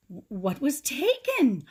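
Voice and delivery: breathless alarmed question; gasping. A narrator's reading. Mom gasps at Grandpa's news, startled. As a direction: stammer it out in alarm. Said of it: "Mom gasped".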